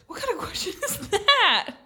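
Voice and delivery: high-pitched incredulous outburst; real disbelief. Real, not acted. Friend balking at a lobster-or-tarantula question, high-pitched disbelief. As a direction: balk at the question, pitch shooting up.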